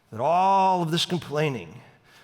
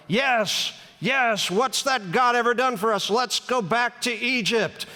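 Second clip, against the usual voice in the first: high-pitched mock yell of an angry crowd; 7 semitones higher than usual. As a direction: cheer him on like a worked-up mob. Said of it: "they're all yelling".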